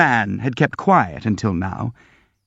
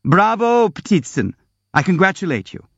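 mocking; 8 semitones higher than usual. Nastasia mocks triumphantly.

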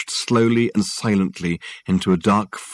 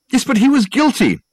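high, loud objection; protesting; 6 dB louder than usual. A narrator's reading. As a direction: shoot back. Richard protests.

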